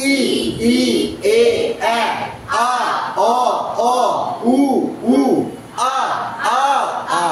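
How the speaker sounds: steady chant from "ই" through "উ" to "আ"; teacher leading "ই, ই, এ, আ" and on, evenly spaced; twelve-vowel drill. Shown as a demonstration. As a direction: sound out each vowel from "ই" to "আ" separately. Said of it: "একসাথে বারোটা এবার করবো".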